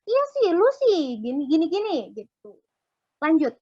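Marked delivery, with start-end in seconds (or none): 0.0-2.0 hammed-up finger-pointing at a friend; blaming snipe. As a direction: push the blame onto them. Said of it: "langsung main salah-salahan".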